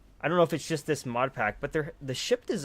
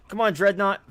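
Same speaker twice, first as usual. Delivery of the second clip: higher, louder cheer on the ship; loud urging; 7 semitones higher, 7 dB louder than usual. Real, not acted. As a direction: call it out like a coach. Urging his ship in battle.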